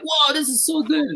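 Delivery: high, amazed rush of joy; excited whoa. Retold or imitated. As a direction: gush like a thrilled kid. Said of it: "And then I was like".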